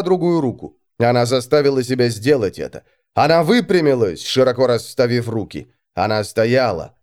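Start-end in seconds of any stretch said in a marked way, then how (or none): none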